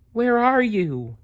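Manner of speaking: put-on high, tiny squeak of a lost baby bat; squeaky baby bat. A narrator's reading. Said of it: "Stellaluna squeaked".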